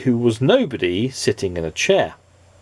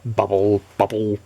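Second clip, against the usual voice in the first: plain reading voice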